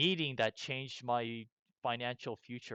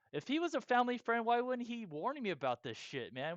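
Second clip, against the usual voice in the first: much higher and fast, outraged; indignant question; 10 semitones higher than usual. Retold or imitated. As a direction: fire it off high, feeling cheated. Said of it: "and now I'm like".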